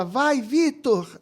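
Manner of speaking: drawn-out call, high and loud; mock shout. Keyboardist imitating the singer calling him on the live tape, stretched high shout. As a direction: call out the name like a live show.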